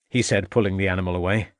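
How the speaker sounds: plain reading voice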